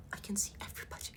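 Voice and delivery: fast unvoiced whisper; hushed aside. Real, not acted. Comic hiding in the office bathroom, fast whisper. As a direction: whisper it quick like you're hiding.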